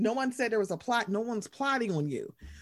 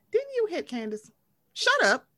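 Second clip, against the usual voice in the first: mock snap; 8 semitones higher than usual. Retold snapping at Chris.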